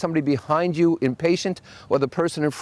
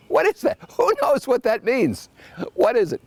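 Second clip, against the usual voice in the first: much higher, incredulous questions; mock bewilderment at the menu; what on earth is that; baffled; 9 semitones higher than usual. Real, not acted. Mocking baffling French menu.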